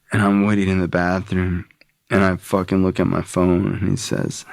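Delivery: usual speaking voice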